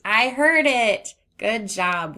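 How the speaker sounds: loud cheerful exclaim; bright triumphant cheer; excited. Acted. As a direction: celebrate hearing the sound. Cheering found sound.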